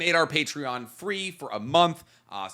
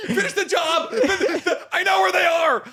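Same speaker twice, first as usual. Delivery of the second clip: high, loud laughing plea; high-pitched pleading; 13 semitones higher, 4 dB louder than usual. Acted. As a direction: beg through laughter, high. Acting Fred wanting death.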